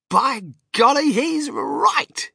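high-pitched astonished exclamation; amazed. A narrator's reading. Grandpa Joe seeing Wonka is right, amazed. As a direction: burst out in delighted disbelief, voice high.

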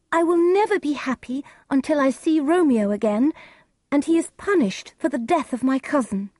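high, forceful grieving daughter; Juliet. Acted. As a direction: declare it high and loud in distress.

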